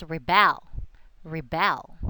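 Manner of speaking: "rebel" with the stress on the end, re-BEL; stress on second syllable. Shown as a demonstration. Teacher models the verb for the class, "rebel" stressed on the last syllable. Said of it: "If it's a verb".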